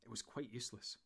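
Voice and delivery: ordinary delivery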